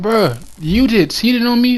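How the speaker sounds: high, slow stunned question; retold shook disbelief. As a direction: drag it out in disbelief. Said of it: "I was so shook when she said it".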